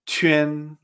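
CHUAN said "chü-en", held level; pronunciation with Q. Shown as a demonstration. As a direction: say CHUAN with ch, ü, then e. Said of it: "con Q".

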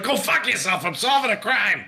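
put-on New York accent. Detective brushing off the janitor, in a New York accent. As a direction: snap it out like a New York cop. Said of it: "Why do you sound like you're from New York?".

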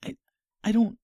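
high, pleading, scared voice; frightened high voice. Acted.

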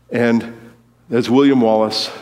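usual speaking voice

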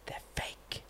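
barely audible, breath-only whisper; hushed acted confession; confiding whisper. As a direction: whisper it like a secret. Confiding a secret to a child.

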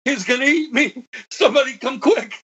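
retold panicked shout, pitched high; panicked yell. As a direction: imitate a terrified man yelling for help. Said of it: "He yelled".

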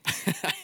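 short unvoiced chuckle; a quick airy laugh; laughing at his own joke; breathy laugh. Real, not acted.

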